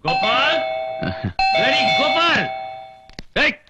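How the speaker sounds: summoning shout; calling out. Acted. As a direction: bellow for the servant, impatient. Drunk father bellows for servant.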